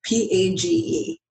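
P A G E said letter by letter; spelling it out. Shown as a demonstration. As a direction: spell out P A G E one letter at a time. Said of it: "your first name is spelled?".

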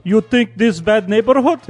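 mock-menacing Russian accent; imitating a threatening Russian. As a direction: switch into a scary stage Russian. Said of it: "mudo pro sotaque russo".

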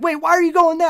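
loud, high-pitched disbelief; exasperated armchair quarterback. Retold or imitated. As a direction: protest like a fan second-guessing the play. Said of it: "I was like an armchair quarterback".

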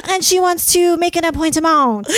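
high, hammed-up French accent. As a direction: mimic a French caller booking. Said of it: "with a French accent".

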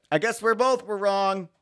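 high breezy shrug; mock shrug. Pedestrian shrugging it off to the cyclist, high and breezy. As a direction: toss it off like a shrug. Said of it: "Throw up your arms like".

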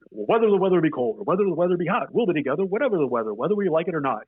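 «Whether the weather be cold» with a bouncing sing-song rhythm on «weather»; tongue-twister cadence. Shown as a demonstration. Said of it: "like, in that cadence".

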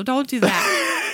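slow and laughing. Real, not acted. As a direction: scold through a laugh. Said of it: "That was terrible and offensive".

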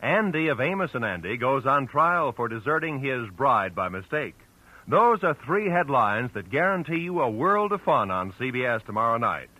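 normal talking voice

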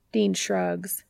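ordinary delivery, nothing marked

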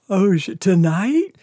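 high retold disbelief, a bit loud; lightly acted sudden realization; mock surprise. He learns the party is now, surprised.

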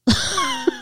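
high, sudden burst of laughter; high amused cackle. Real, not acted. Amused by the attic detail.

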